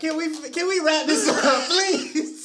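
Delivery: pleading through laughter; giggling request to stop; laughing plea. Real, not acted.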